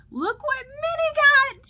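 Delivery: loud, high-pitched squeal of excitement; excited exclamation. Real, not acted. Pastor showing children Minnie's new coffee cup, excited. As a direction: show off a find with drawn-out, high delight. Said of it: "I was very excited".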